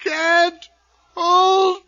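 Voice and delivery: high, slow, crying shout; fighting his own hand and losing; tortured wail of struggle; anguished straining cry. Acted. Bob losing control of hand.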